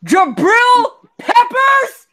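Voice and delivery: high, loud, slow cry of disbelief; real anguished shout; outraged disbelief. Real, not acted. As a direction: wail the name in shock. Disbelief at trade return.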